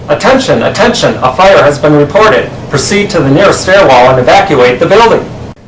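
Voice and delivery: loud PA announcement voice; evacuation order. Acted. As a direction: boom it like a building alarm. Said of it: "followed by the following message".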